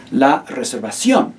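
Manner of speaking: reservación with the stress on the last syllable, ción. Shown as a demonstration. Teacher showing the class where reservación takes its stress. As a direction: lean on the accented ción of reservación. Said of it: "that's the syllable that you stress".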